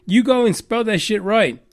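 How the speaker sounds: ordinary talk, nothing marked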